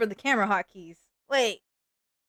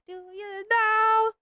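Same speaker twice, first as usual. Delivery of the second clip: high drawn-out question; 11 semitones higher, much slower than usual. Acted.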